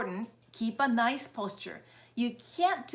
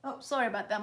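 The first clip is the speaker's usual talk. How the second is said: ordinary talk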